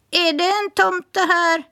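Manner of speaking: high parrot voice repeating the question; parrot echoing. Acted. Parrot echoing Smirke's question, high bird voice. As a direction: mimic it back like a parrot. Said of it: "svarade rösten tätt in på honom".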